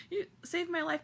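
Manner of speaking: high, choked-up thanks; tearful gratitude. Retold or imitated. Fan thanking Barbara through tears, high and choked. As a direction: say it as if close to tears.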